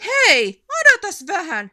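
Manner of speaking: high, loud urgent call; calling out to stop. A narrator's reading. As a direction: stop them mid-fight. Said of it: "sanoi Ärmätti". (huutaa pysähtymään)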